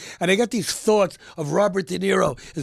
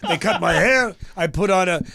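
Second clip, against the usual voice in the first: ordinary talk, nothing marked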